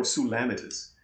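"Sulamitis" said soo-LAM-uh-tis; "Sulamitis" with stress on the short-a second syllable; another possible pronunciation. Shown as a demonstration. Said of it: "proper pronunciation".

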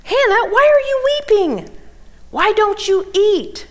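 high, loud baffled husband voice; puzzled husband. Retold or imitated. Husband questioning his weeping wife, high and bewildered. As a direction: ask it like a clueless, bewildered man. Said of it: "notice what he says to her".

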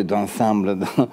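normal talking voice

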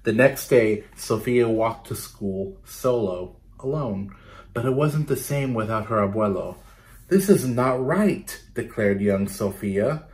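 put-on lively, rhythmic read-aloud for kids; storytelling to children. Teacher reading a picture book to her class, lively read-aloud. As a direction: bounce the rhymes for a class of children.

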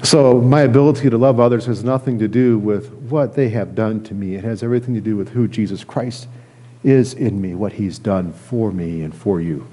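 ordinary talk

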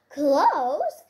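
loud rising outraged question; husband shouts back at his wife; indignant shout. A narrator's reading. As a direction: hurl the word back at her. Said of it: "shouted Sandy".

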